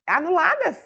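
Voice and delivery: ordinary delivery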